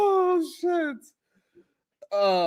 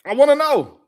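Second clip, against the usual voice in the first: sudden loud demand, pitched up; 5 semitones higher, 7 dB louder than usual. Real, not acted. Host demanding an answer from the co-host, loud. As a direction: bark out the demand.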